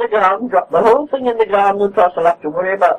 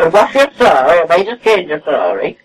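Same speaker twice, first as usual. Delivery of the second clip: normal talking voice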